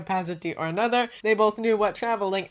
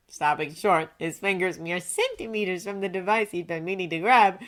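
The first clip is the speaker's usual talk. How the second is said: ordinary delivery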